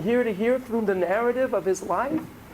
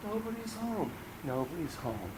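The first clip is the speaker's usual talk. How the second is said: much softer hollow echo; hushed, lonely; 12 dB quieter than usual. Acted. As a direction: let it fade into emptiness. Echoing empty house.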